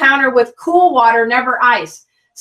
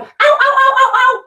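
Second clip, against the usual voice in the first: hurt dog yelping; 12 semitones higher, faster than usual. Retold or imitated. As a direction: yelp like a scalded pup. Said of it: "the dog will be going".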